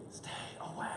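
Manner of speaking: hushed, voiceless warning; retold whisper. Friend secretly warns husband on the phone, whispered. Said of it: "in a whispered voice he adds".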